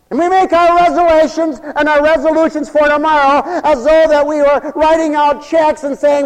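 loud, high-pitched sermon delivery; full-force preaching. Acted. Preacher mocking resolutions made for tomorrow, full-force preaching.

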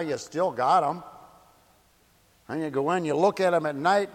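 normal talking voice

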